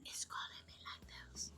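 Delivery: soft breathy ASMR whisper. Pretend. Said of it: "M S M R with me?".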